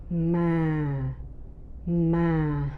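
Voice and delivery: "ma" low, long and sinking; second, falling tone. Shown as a demonstration. Teacher modelling the second tone, low falling. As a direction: draw "ma" out downward like a pondering hum. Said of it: "that's the second tone".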